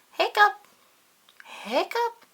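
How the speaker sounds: high, loud squeaky hiccup. Acted. Pete hiccups loudly in the story. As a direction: pop out a sudden hiccup.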